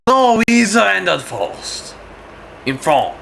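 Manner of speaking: high, drawn-out mock French protest; French accent. The French arguing they surrendered first, French accent. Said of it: "And the French go".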